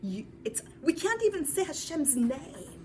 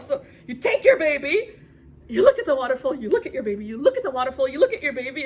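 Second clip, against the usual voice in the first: loud, driving back and forth; urgent loud telling; 9 dB louder, much faster than usual. Acted. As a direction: pound each look harder. Torn between waterfall and baby.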